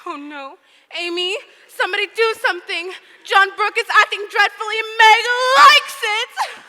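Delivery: loud, high, rising alarm; dismayed outcry. Acted. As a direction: cry out in comic horror. Jo cries out in dismay.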